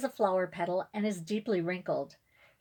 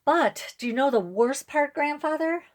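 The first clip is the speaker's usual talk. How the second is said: higher and louder, near tears; voice rising high; 7 semitones higher, 5 dB louder than usual. A narrator's reading. Girl telling great-grandfather about her cut hair, rising voice. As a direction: let the voice climb as she fights tears. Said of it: "how high my voice has become".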